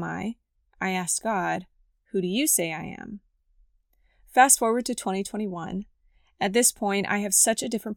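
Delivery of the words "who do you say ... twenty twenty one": ordinary talk, nothing marked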